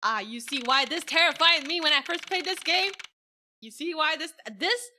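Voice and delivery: high, loud, worked-up voice; rattled exclaiming. Real, not acted. Gamer explaining old terror to viewers, rattled and loud. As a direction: exclaim it high, still shaken by the scare. Still rattled after scare.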